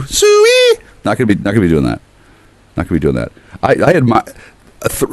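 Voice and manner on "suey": high, loud hog-call. Pretend. Riffing on the name Sue with a high hog call. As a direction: holler it like calling pigs.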